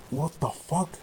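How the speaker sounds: veel hoger maar zachter, verbijsterd; stunned disbelief. Real, not acted. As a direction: echt ongelovig, bijna lachend. Reacting to mocked film line.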